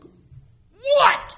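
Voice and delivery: very high, loud What then Whoa; squealed disbelief demo; astonished. Shown as a demonstration. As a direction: act out being stunned. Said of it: "That's what astonishment is".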